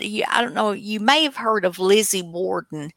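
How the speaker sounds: ordinary talk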